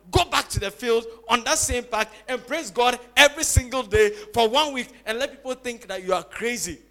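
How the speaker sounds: loud, driving exhortation; preacher pressing each phrase home loudly; forceful preaching. Acted. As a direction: urge the congregation on at full voice.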